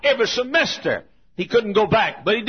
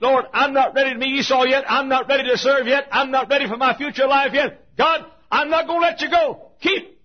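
fervent plea; 7 semitones higher than usual. Retold or imitated. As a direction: plead like you're wrestling and won't let go. Jacob pleading with God, wrestling.

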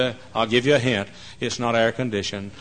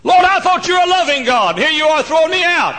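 very high, loud accusing cry; shouted protest; 14 dB louder than usual. Acted. Believer complains to God of being thrown out, shouted protest. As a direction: protest at the top of your voice.

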